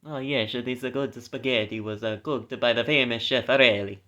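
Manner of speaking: hammed-up mock Italian. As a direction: play it as a cartoonish Italian accent. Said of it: "Stereotypical Italian".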